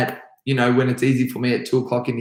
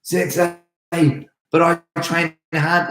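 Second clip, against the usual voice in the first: higher, whiny student protest; lightly voiced student begging the sensei; pleading student; 6 semitones higher than usual. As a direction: plead like a kid who wants his grading. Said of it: "Sometimes students come up".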